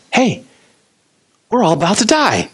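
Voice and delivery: high-pitched acted alarm; mock freaked out. Disciples waking Jesus in the storm, mock alarm. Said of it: "They freaked out".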